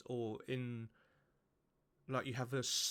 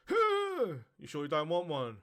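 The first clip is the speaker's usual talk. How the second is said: startling ghost; 3 semitones higher, 6 dB louder than usual. Retold or imitated. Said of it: "ghost offers again".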